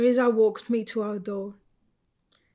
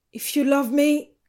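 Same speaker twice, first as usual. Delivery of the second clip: raised, higher accusing voice; angry challenge; 5 semitones higher, 7 dB louder than usual. A narrator's reading. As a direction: throw it out as a loud challenge. Angry challenge during lovers' quarrel.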